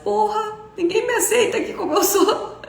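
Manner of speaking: high-pitched whiny mock complaint; hammed-up hurt child protesting; sad rejected child. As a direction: wail it in a comic sulk, near laughing. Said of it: "ela fica triste e ela diz".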